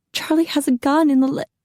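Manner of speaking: high, anxious rush, cut off; frightened wife blurting a warning; agitated. A narrator's reading. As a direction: blurt out the danger. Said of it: "growing more agitated".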